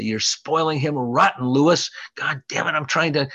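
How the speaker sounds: high, loud mock yell at the father; grandmother yelling. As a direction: yell at Lewis like an angry grandma. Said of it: "my grandmother would yell at my father".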